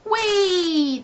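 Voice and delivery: high, joyful sledging shout; gleeful whee. A narrator's reading. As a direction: slide downhill squealing with glee. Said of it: "they shouted together".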